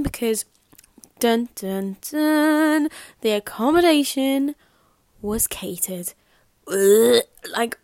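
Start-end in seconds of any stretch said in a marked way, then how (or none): 1.2-2.9 spoken "dun, dun, dun" drumroll, last one higher and louder; mock suspense sting. Vlogger teasing the bad news about her halls, mock suspense. 3.2-6.1 dramatic reveal. Acted. Vlogger revealing the catch about her halls, mock-dramatic. 6.7-7.2 loud groan of disgust; a big retching "ugh". Real, not acted. Vlogger reacting to the catered food, disgusted.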